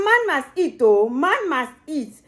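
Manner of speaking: ordinary talk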